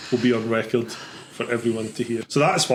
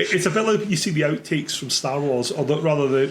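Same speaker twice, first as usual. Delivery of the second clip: ordinary talk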